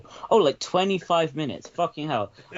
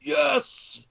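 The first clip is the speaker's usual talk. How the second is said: Alex Jones impression; 13 semitones higher than usual. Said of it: "Is this what you've come to say?".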